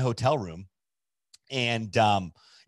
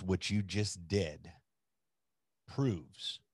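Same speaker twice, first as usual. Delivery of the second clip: low, soft, measured words with long pauses; solemn heartfelt lesson; 5 semitones lower, 8 dB quieter, slower than usual. Retold or imitated. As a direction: deliver it quietly, letting each phrase land. Friend gives him the lesson.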